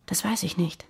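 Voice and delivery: soft evasive whisper. Acted. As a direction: barely breathe the denial. Evasive answer about the attack. (leise geflüstert, ausweichend)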